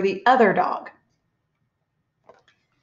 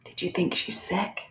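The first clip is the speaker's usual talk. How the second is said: softly whispered question to the class; hushed, secretive whisper; thinking voice; 9 dB quieter than usual. Shown as a demonstration. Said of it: "Use your thinking voice".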